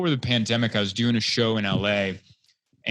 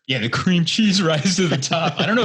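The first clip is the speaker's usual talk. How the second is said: laughing; 7 semitones higher, 5 dB louder than usual. Real, not acted. Laughing at the cream cheese joke.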